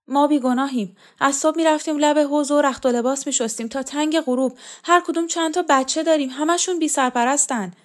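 high, loud protest of innocence; pleading. Acted. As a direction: plead for yourself and your children. Woman pleads innocence to interrogator.

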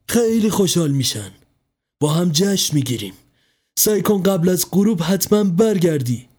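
cling to hope, lifted and eager; hopeful mother. A narrator's reading.

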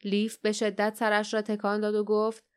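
ordinary delivery

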